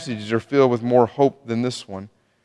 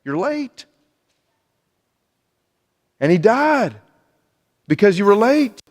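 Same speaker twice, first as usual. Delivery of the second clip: higher-pitched, accusing complaint; disappointed reproach; 7 semitones higher than usual. Acted. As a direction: reproach someone who came too late. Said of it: "I would have been disappointed".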